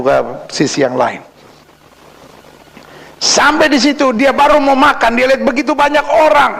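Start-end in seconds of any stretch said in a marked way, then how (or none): 3.2-6.5 high, projected preacher's telling; dramatic sermon storytelling. Acted. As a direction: raise the pitch to build the scene. Preacher tells story at full force. (bercerita di mimbar dengan nada tinggi)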